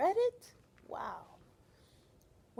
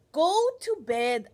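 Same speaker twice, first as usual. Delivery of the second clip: firm mother; 4 semitones higher, 10 dB louder, slower than usual. Acted.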